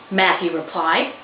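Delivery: plain reading voice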